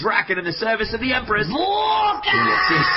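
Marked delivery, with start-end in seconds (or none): 1.5-2.9 very high astonished shout; stunned high-pitched call; surprised cry. Real, not acted. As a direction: gasp at the newcomer. Something appears over the hill.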